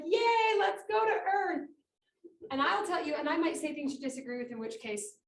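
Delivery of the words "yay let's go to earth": high, loud, bubbly cheer; eager cheer. Retold or imitated. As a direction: cheer it out like a kid heading to a party. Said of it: "and I'm like".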